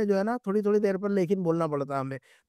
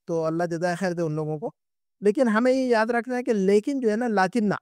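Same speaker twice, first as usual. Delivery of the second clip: usual speaking voice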